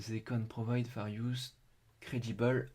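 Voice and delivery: slow, soft reading in a heavy French accent; bad French-accented English. Real, not acted. Streamer reading the English page aloud, rough French accent. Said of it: "c'est mon accent qui est pourri".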